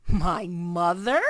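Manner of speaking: slow surprised giant cry. A narrator's reading. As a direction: cry it out in disbelief. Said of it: "cried the B F G".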